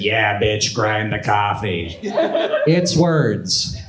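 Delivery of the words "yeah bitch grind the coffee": put-on low, slow tough-guy baby voice; a lightly voiced mean baby, lower and slow. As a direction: sneer it down like a tiny thug. Baby voiced insulting narrator.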